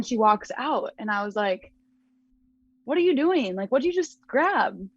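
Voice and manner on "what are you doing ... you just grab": 1 baffled. Retold or imitated. Roommate grabs her backpack at night.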